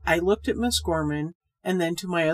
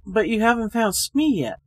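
complaining; 4 semitones higher, 6 dB louder than usual. A narrator's reading. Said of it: "I complained".

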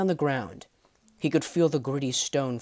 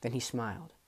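plain reading voice

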